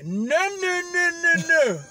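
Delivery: very loud, very high string of no; furious yell. Retold or imitated. Refusing a new pair on the phone, yelling. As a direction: shout them down.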